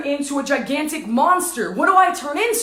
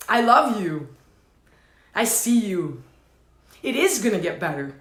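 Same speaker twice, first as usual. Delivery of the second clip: low, soft, heartfelt comfort; gentle, lowered voice of comfort; tender reassurance; 6 semitones lower, 4 dB quieter than usual. Real, not acted. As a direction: reassure them quietly, pitched down. Comforting listeners who are crying.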